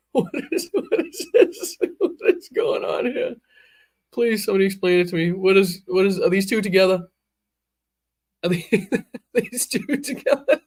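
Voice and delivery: high, squeaky words through laughter; laughing disbelief. Real, not acted. Streamer laughing at an odd couple in the crowd. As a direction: giggle out the question.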